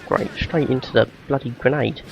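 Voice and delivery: ordinary delivery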